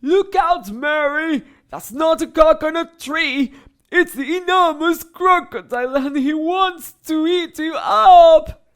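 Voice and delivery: loud, high alarm, slowing at the end; urgent warning shout. A narrator's reading. Hippo shouting to Mary about the crocodile. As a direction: bellow the danger to her.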